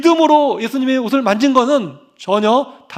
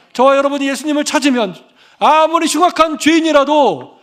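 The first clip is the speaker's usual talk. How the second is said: rising, louder preaching climax; 3 semitones higher, 4 dB louder than usual. Acted. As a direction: build fervor toward the holiness point.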